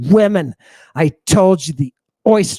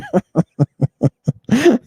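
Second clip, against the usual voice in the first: high, chuckling burst of laughter; real laugh; 7 semitones higher than usual. Real, not acted. Lecturer cracking up at his own reading, real chuckling laugh. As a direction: let a run of giggles out. Laughs at bizarre behavior line.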